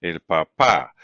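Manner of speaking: "papá" with the final pá stressed; teacher's model, weight on the end of "papá"; stress on last syllable. Shown as a demonstration. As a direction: repeat "el papá" as pa-PÁ. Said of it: "here the last syllable is stressed".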